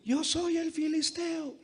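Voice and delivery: a thin high giant, the take he rejects; wrong Goliath voice. Shown as a demonstration. Preacher's first try at Goliath, thin and high.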